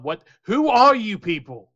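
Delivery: high, loud, bewildered demand; startled goblin challenging strangers; alarmed. Acted. Goblin confronts strangers on deck.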